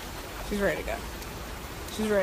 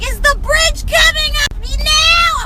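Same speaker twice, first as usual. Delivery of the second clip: loud, high, slow child imitation; impatient little girl; 5 dB louder, much slower than usual. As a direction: holler it like a nagging kid. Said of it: "she was like".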